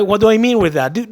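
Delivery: ordinary talk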